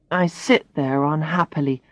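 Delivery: plain reading voice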